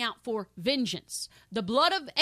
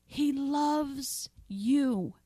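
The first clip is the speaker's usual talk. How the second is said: tender; 2 semitones higher, much slower than usual. Real, not acted. Tender repetition to congregation.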